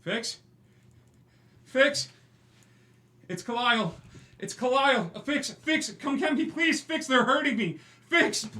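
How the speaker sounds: high, loud terrified calls for help; desperate screaming. Acted. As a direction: scream for your brother from the locked room. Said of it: "he just starts screaming out".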